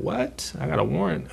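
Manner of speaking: imitating your own stunned reaction; retold shock. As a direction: echo the news back in mild shock. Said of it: "I was shocked".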